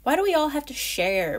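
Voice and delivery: put-on a sulky squirrel kid wailing. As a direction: whine it out in protest. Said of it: "Beck wailed".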